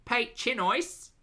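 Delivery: milk the bad Aussie French for a laugh; Ocker French. Shown as a demonstration. A streamer cracks herself up with Ocker French. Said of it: "I love doing the Ocker French".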